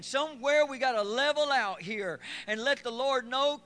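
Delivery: high, loud shouting preacher; full-force preaching. Acted. Pastor shouts to the church about keeping joy, high and loud. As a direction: preach it out at the top of your voice.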